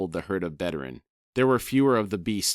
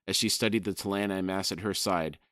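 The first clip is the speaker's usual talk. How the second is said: ordinary talk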